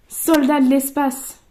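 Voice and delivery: loud, slow title reveal; card announcement. Real, not acted. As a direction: announce the card grandly and slowly. Announcing each card drawn.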